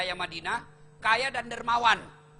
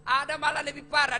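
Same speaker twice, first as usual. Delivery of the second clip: ordinary talk, nothing marked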